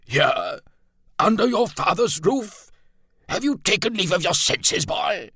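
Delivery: very high, blustering colonel; outraged disbelief. A narrator's reading. Colonel scolding the boy about his father's roof, high and outraged. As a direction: splutter upward in scandalized disbelief.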